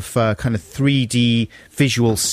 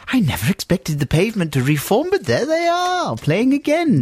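much higher mock posh mother voice; posh mum; 9 semitones higher than usual. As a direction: play a prim mother spotting a band. Mock posh parents skit.

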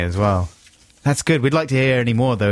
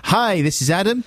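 high-pitched mock radio greeting; high, teasing impression of a co-host; mocking co-host's introduction; 9 semitones higher than usual. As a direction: imitate an awkward stage introduction, high. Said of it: "That was your response".

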